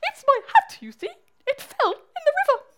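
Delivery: high, loud tearful bat; cry and quiver. Acted. Bat has lost its hat, high loud quivering cry. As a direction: wail it in a hammed-up squeal. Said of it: "with a cry and a quiver".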